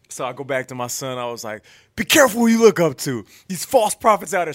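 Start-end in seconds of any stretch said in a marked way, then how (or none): 1.9-4.5 high, loud mock warning; mock sermon. Dad teasing his son after the game winner, loud mock preaching. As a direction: preach at the boy with fake gravity.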